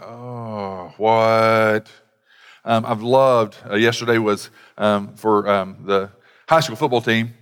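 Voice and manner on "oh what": put-on low, flat-toned grunt of a bothered teen; mock teen brush-off at the door; sullen teen. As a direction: answer like an annoyed teenager interrupted. Teen answering parent at door.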